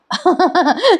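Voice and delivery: a short giggle at her own joke; amused giggle. Real, not acted. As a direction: laugh at the joke. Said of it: "समोसे खाऊन".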